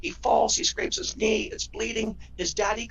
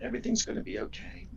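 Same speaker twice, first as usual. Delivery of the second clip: comforting whisper; 2 semitones higher, 5 dB quieter than usual. Retold or imitated. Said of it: "and say".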